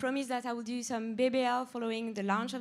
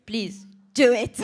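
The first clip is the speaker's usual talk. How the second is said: louder and slow, half-joking command; mock-stern plea; 10 dB louder than usual. Real, not acted. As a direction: insist with playful firmness. Urging staff to set objectives.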